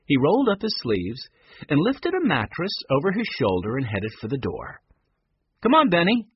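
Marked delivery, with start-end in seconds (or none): 5.6-6.3 louder call across the room. A narrator's reading. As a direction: call him over. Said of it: "he called".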